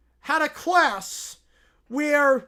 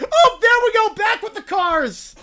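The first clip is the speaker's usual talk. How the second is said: excited; 8 semitones higher, 10 dB louder than usual. Real, not acted. Excited reaction to cars returning.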